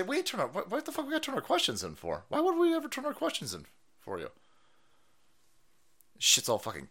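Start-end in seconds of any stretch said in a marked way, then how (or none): 0.0-4.3 high, fast outraged protest; imagined indignant reporter. Retold or imitated. Urging reporters to protest, high rapid indignant outburst. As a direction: fire off the protest like a fed-up journalist. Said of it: "stand up and say".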